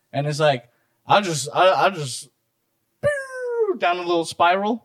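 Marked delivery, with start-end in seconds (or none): 3.0-3.8 high-pitched whistling zoom downwards; imitated sound of spiralling away; high falling "pew" noise. Shown as a demonstration. Showing how a rant spirals off, high falling noise.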